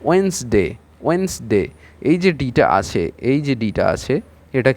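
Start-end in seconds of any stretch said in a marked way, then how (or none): 0.1-0.6 ওয়েন্সডে with the first d silent; ওয়েন্সডে, n running straight into s; correct pronunciation. Shown as a demonstration. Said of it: "এর সঠিক উচ্চারণটা হচ্ছে". 1.1-1.6 ওয়েন্সডে with the first d silent; ওয়েন্সডে, n running straight into s; correct pronunciation. Shown as a demonstration. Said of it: "এর সঠিক উচ্চারণটা হচ্ছে".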